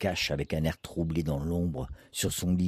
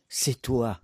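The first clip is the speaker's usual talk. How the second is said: mild cry of recognition, pitched up; understated narrated cry, a little higher; cried out; 4 semitones higher than usual. A narrator's reading. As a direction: exclaim it to the returning husband. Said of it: "cria Jeanine".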